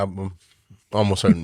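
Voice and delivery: unsure mumble, dropping low mid-phrase; mumbling. Real, not acted. Host fumbling for the backup quarterback's name, mumbling. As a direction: stumble and mumble through the answer. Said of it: "I'm mumbling over that".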